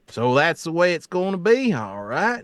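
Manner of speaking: lightly put-on country accent. Shown as a demonstration. Game master showing his fallback voice, mild country accent. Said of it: "some country voice, which is usually like".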